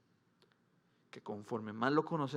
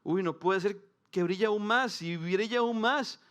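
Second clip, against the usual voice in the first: higher, louder and slow, full of wonder; amazed; 5 semitones higher, 5 dB louder, slower than usual. Retold or imitated. A believer marveling at the treasure, slow, high and loud. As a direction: let the awe grow as you say it. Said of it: "uno decía".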